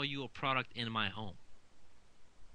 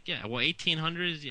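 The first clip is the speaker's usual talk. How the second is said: ordinary delivery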